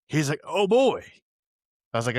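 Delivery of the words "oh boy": surprised. Retold or imitated. Doctor reacts to dead ENT.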